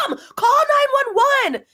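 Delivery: high, loud panicked shout. Retold or imitated. Daughter yelling at mom on the phone, panicked shout.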